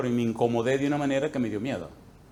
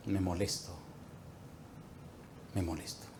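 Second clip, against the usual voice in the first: quiet admission; 7 semitones lower, 16 dB quieter than usual. Real, not acted. Pastor admits anger softly.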